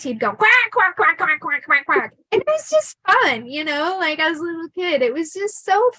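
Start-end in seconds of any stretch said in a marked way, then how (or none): 0.3-2.1 loud, higher run of quacks; big excited quacking. Retold or imitated. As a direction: quack over and over like an excited duck. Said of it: "big coins".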